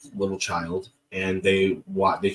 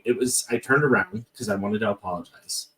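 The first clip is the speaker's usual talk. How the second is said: usual speaking voice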